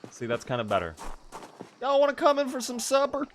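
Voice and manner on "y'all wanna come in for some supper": very high-pitched Southern host; Southern accent. Shown as a demonstration. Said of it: "It's hard to do a Southern accent".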